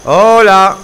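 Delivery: loud call into the dark. Real, not acted. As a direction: shout hello into the void. Calling out in dark game. (llamada a gritos)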